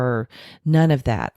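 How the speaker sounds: ordinary talk, nothing marked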